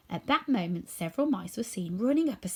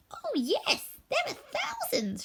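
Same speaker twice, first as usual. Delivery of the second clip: much higher, slow and proud; proud squeaky queen; slower than usual. A narrator's reading. Mouse queen telling the scarecrow, high squeak. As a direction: boast of your many subjects.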